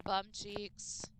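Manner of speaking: usual speaking voice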